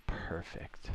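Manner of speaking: breathy whisper of "Perfect". Real, not acted. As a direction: whisper the word "Perfect" softly. Satisfied after fixing power setup.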